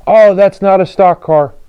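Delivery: high, loud mock scream; retold scream. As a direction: shriek it like the angry guy at the race. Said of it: "Guy starts screaming".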